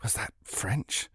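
unvoiced frightened whisper. A narrator's reading. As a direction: whisper the question, barely audible. Wondering aloud in fear.

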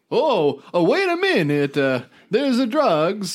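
a light Mario impression, peering at pipes. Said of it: "Don't do it in Italian".

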